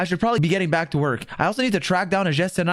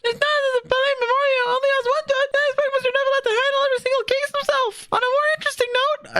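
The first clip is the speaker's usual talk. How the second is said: much higher, bright reading of a chirpy journalist; high reporter voice. Acted. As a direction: read it like an eager young reporter, pitched way up. Reads Charlotte's lines aloud.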